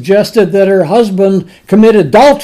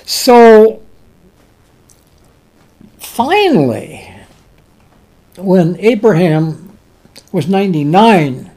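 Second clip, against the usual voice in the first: ordinary talk, nothing marked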